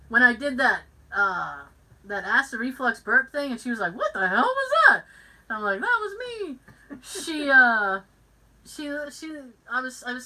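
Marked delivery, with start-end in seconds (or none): 3.9-5.0 loud, high, fast disbelief; shocked outburst. Retold or imitated. Retells coworker's startled reaction. 5.8-6.6 high little confession; imitated guilty squeak; sheepish admission. Sheepish owning up to the burp.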